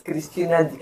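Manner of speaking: ordinary delivery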